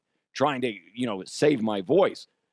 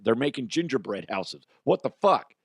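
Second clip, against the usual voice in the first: ordinary delivery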